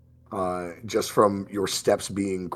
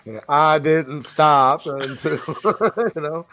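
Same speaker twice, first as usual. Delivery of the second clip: Forrest Gump; 9 semitones higher, 4 dB louder than usual. Acted. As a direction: stretch the words out high, amused. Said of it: "I've always loved Forrest Gump".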